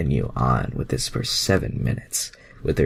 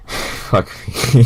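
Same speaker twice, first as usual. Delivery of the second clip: ordinary talk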